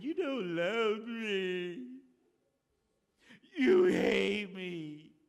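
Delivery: slow, loud mock wail; hammed-up weeping wife. Samson's wife wailing at him, hammed-up and slow. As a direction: drag out each word like a sulking wife. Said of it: "came to him in tears and said".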